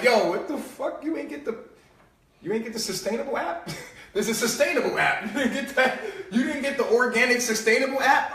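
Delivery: teasing disbelief breaking into laughter; laughing mockery. Mocking someone without the sustainable app, laughing. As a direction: tease them, laughing as you go. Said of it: "we looking at people like".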